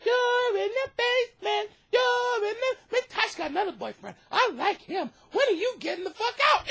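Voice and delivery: high, squeaky mocking child voice; taunting little kids. Kids telling him about his life, high taunting voice. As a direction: mimic a kid needling an adult. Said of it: "little motherfuckers wanna go ahead and tell me".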